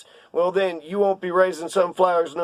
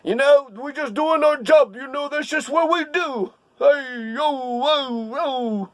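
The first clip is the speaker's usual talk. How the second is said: high-pitched Brooklyn police union guy; Brooklyn accent; 6 semitones higher, slower than usual. Acted. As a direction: ham up a Brooklyn cop, pitched up. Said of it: "That's my Brooklyn accent, or whatever that guy was".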